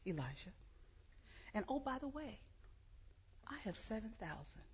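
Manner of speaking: soft hushed voice, some words whispered; still small voice. Acted. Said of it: "God shows up in a still small voice".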